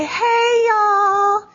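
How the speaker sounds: high mock Southern drawl on y'all; imitating Southern girl. Southern camper mimicking her counselor, high mock Southern twang. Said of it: "one girl would say".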